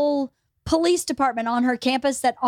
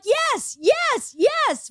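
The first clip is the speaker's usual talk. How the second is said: high, insistent yes yes yes; emphatic exasperated yes yes yes; emphatic yeses; 12 semitones higher, much slower than usual. Retold or imitated. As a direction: hammer the yeses home, exasperated. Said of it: "And I'm like".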